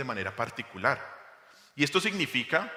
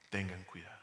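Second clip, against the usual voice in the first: soft warning; 5 semitones lower, 12 dB quieter than usual. Shown as a demonstration.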